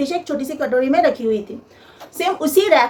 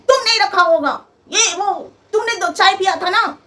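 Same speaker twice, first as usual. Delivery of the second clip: accusing mother-in-law; 6 semitones higher than usual. Retold or imitated. Mother-in-law confronting the bride.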